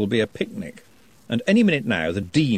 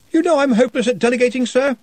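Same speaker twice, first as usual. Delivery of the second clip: higher, louder, rushed pleading; flustered protest; 13 semitones higher, 5 dB louder than usual. Acted. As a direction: panic about the workload. Ponder protests to Ridcully.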